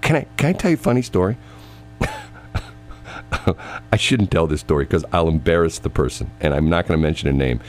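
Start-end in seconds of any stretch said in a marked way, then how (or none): 0.0-1.4 mock comeback. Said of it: "I go".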